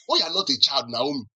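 ordinary talk, nothing marked

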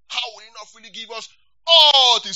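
rising to a loud cry; preacher's climax shout; building to a shout. Real, not acted. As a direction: let the question swell to a shout.

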